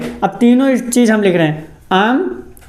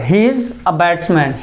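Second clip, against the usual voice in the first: slow model sentence; 4 semitones lower, much slower than usual. Shown as a demonstration. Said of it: "तो क्या बोलेंगे यहाँ पर?".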